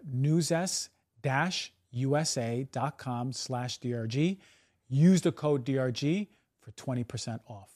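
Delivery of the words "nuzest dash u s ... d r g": slow web address. Shown as a demonstration. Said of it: "That's Nuzest, N U Z E S T".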